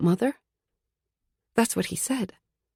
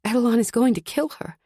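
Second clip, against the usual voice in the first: usual speaking voice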